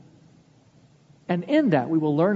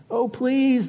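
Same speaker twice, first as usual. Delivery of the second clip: higher, mock-begging plea; begging students; 8 semitones higher, 3 dB louder than usual. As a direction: whine a bit like a desperate student. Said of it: "they begged".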